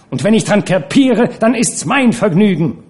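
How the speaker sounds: high, loud, defiant cry; shouting. A narrator's reading. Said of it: "sie schrie noch lauter".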